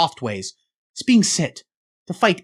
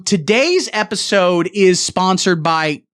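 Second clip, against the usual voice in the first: ordinary delivery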